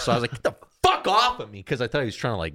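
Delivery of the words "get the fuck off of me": high mock yell of repulsion; startled angry yell. Teen shoving friend who fell on him, mock angry yell. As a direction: burst out as if shoving someone off.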